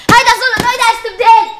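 loud wounded outrage at the unfairness; indignant protest. Acted. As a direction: protest it like a wronged kid. Child protests unfair treatment.

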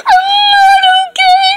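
high, sobbing doll voice, hurt; crying doll. Pretend. Bitten doll tells her friend she is hurt, crying high. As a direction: wail it like a bitten toddler doll.

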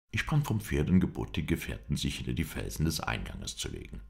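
usual speaking voice

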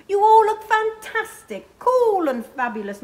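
high, loud, fussing over her darlings; gushing wardrobe mistress. A narrator's reading. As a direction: coo over the performers like a theatrical dresser. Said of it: "says the wardrobe mistress".

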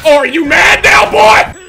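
very high, full-volume jeer; screamed taunt. Real, not acted. Gamer taunting the opponent mid-match, screamed taunt.

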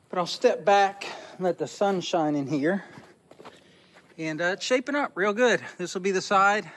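usual speaking voice

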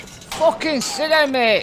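high, slow gloating taunt; drawn-out taunt. Real, not acted. Gamer gloating over a downed enemy, high and slow. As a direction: stretch it out to mock the fallen enemy.

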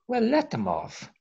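mock the bishops waving people away; sarcastic "Well, let them off" said sharply high; dismissive bishops. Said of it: "a lot of them say".

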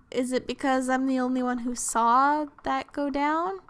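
normal talking voice

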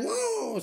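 long, high held howl; mock wolf howl, stretched out; wolf howl imitation. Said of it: "σαν το λύκο".

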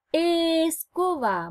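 "Escoba" slow, opening E stretched out; "Escoba" said slowly with a long clear E; over enunciating. Shown as a demonstration. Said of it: "comienzan con el sonido E".

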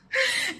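short high-pitched laugh. Real, not acted. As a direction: burst out laughing. Laughing at the arrest story.

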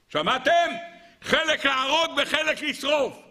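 high, slow, emphatic outcry; incredulous exclamation. Real, not acted. Preacher appalled at the cabinet's verdict, exclaiming. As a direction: cry it out high, drawing out both options.

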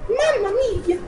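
very high mock-Italian exclamation; Italian accent. Gamer showing off his voices, in a high Italian accent. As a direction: squeal it as a cartoon Italian. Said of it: "There's my Italian".

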